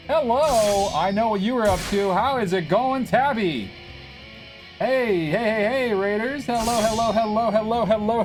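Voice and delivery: high, bouncy, excited hellos; excited high greeting. Real, not acted. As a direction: greet the raiders with big excitement. Greeting raid on stream.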